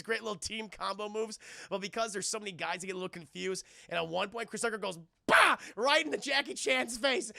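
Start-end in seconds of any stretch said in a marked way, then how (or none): 5.3-5.6 loud, high "bah" burst; one explosive mock impact sound; imitated punch noise. Tucker hitting Jackie Chan's face, loud imitated blow.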